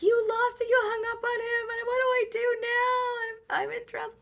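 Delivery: high, loud hammed-up wail; panicked wail. Blaming the cop who hung up, panicked wail. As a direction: wail it like a frantic accusation. Retold panic at the lost call.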